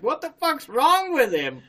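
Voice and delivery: high-pitched mock bewilderment; imitating baffled onlookers. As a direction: ask it like an incredulous friend, half laughing. Friends' reaction on seeing him.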